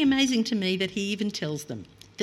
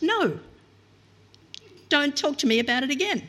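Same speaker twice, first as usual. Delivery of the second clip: higher, firm parental no; stern parent; 7 semitones higher than usual. Retold or imitated. A parent refusing a child's pleading, stern and final. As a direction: shut the discussion down like a fed-up parent. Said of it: "familiar words to any loving parent".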